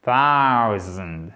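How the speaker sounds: THOUSAND lent, diphtongue AO étirée; étire le AO de thousand, voix plus grave; diphtongue démontrée. Shown as a demonstration. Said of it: "C'est un double son". (thousand décomposé lentement)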